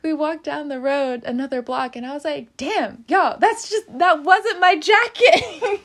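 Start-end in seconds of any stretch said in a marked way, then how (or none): 2.6-5.8 high, louder and slower, laughing through it; laughing disbelief. Real, not acted. Telling friends down the road, laughing disbelief.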